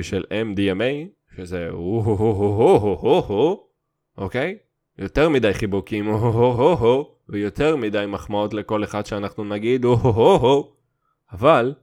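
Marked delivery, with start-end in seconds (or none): 1.7-3.7 euphoric hooting. Retold or imitated. Mimicking MDMA euphoria. 6.1-7.1 euphoric hooting. Retold or imitated. Mimicking MDMA euphoria. 9.8-10.7 euphoric hooting. Retold or imitated. Mimicking MDMA euphoria.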